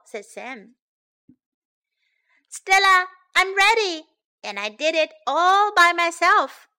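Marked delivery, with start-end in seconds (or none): 2.5-6.7 high, loud announcement; raised, excited call; calling out proudly. A narrator's reading. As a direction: announce it triumphantly to another room. Sam proudly announces to Stella.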